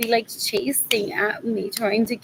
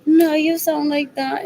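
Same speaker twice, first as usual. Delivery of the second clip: mock pleading wife; 5 semitones higher, 5 dB louder than usual. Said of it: "As in".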